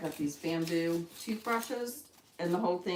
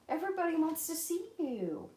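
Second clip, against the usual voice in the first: high pet cheer; 10 semitones higher than usual. Real, not acted. Cheering the bird on.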